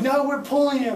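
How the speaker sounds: yelling down from two hundred feet; retold scream. Stuck on the ride, yelling down to the operator. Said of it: "trying to scream at him".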